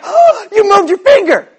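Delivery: hammed-up rejoicing at a tiny wiggle; over-the-top amazement. Friends cheering the revived man's finger, in a hammed-up shout. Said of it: "And they're all like".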